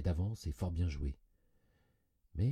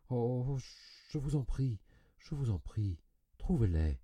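higher-pitched, distressed begging; anguished pleading; 7 semitones higher, 3 dB louder than usual. A narrator's reading. Cousin begging him to find the money, anguished. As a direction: beg as if in pain. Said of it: "Elle poussa une sorte de cri de souffrance".

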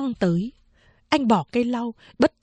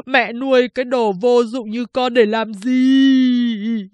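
high, slow, drawn-out wailing to his mother; keen like a grieving woman, stretching every cry; wailing lament; 5 semitones higher, slower than usual. Acted. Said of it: "vừa khóc vừa kể lể như đàn bà".

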